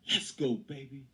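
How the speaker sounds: loud, high rallying yell; screamed hype-up. Retold or imitated. Auditioning to get a football team hype, screamed rallying cry. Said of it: "I started screaming".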